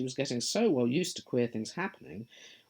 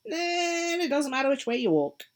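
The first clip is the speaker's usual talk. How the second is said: high, loud, sly grinning cat voice; a teasing cat, pleased with itself; Cheshire Cat; 10 semitones higher, 5 dB louder than usual. Acted. The cat answering lost Alice, high and sly.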